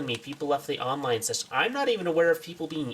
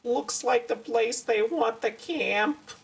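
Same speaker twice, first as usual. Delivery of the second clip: high, slow mocking character voice; mock the character, pitched up and drawn out; 7 semitones higher, slower than usual. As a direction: drag it out in a high, mocking put-on voice. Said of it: "I gave him the mocking voice".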